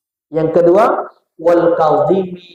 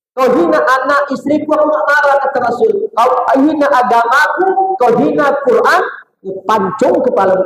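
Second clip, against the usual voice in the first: quoting the Prophet; 8 semitones higher than usual. Retold or imitated.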